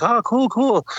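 high, fast polite brush-off, a mock forced enthusiasm. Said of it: "And she's like".

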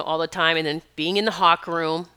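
ordinary delivery

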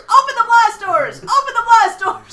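shouting. Retold or imitated. Quoting movie commander order.

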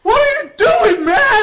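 pained shout. Acted. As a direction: yell it in pain and panic. Arm about to be burned.

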